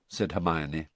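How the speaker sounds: plain reading voice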